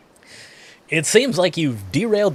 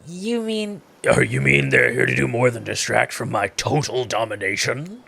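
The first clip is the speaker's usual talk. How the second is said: Wraith voice; 2 semitones lower than usual. Acted. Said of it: "That, that was Wraith".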